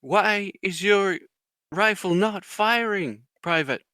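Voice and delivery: slow, high mock interrogation; drill sergeant. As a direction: imitate a sergeant demanding an answer, word by word. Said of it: "he's like".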